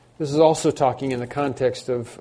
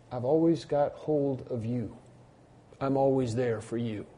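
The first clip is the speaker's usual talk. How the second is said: tender reassurance; 6 dB quieter than usual. Acted. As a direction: reassure gently, taking your time. Voicing the Lord reassuring believers.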